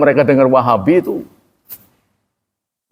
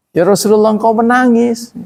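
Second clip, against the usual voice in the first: surprised companion; 9 semitones higher than usual. Retold or imitated. Teacher voicing a companion surprised the Prophet cries, mock surprise. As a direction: voice a follower amazed, half-teasing.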